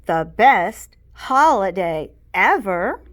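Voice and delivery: loud, slow, gushing; enthusiastic. A narrator's reading. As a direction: relish every word. Kid blurting enthusiasm in class.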